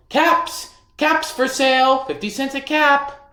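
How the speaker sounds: high, loud peddler's cry; calling out wares. A narrator's reading. Peddler calling to passersby, loud and high. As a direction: sing it out to the street. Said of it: "he called".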